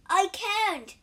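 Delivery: high, loud, mild distress; distressed protest. A narrator's reading. Injured monk asked to stand up, distressed protest.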